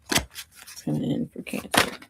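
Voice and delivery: slow quiet whisper. Pretend. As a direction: whisper it slowly. Repeats the line, second time whispered.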